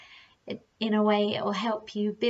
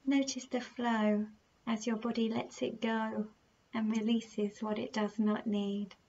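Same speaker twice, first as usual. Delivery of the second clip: slow, soft meditation voice with unhurried, drawn-out phrases; guided meditation; 4 dB quieter than usual. Acted. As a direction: gently guide the listener through their breathing, leaving long pauses.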